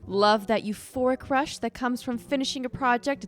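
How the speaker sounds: upbeat commercial announcer; ad read. Acted. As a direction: sell it like a TV ad.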